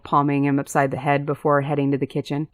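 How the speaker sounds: ordinary delivery, nothing marked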